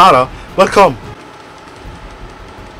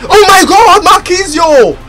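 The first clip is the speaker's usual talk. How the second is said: excited yell; 7 semitones higher, 4 dB louder than usual. Real, not acted. Pack pull reaction.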